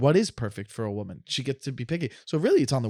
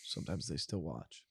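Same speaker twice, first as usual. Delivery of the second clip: hushed aside; 9 semitones lower, 16 dB quieter than usual. Real, not acted. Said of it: "aside about ex-wife's parents".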